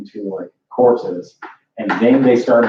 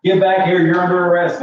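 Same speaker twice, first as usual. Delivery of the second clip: guard yelling after a fleeing swimmer; angry guard yelling; 8 semitones higher than usual. Acted. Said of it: "yelling at you".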